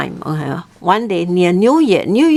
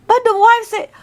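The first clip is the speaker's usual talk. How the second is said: ordinary delivery, nothing marked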